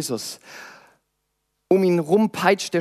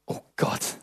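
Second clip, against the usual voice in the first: breathless whispered "Oh Gott"; a whispered prayer of panic; whispered gasp; 9 dB quieter than usual. Acted. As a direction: let it slip out on a frightened whisper. Helpless gasp when sinking.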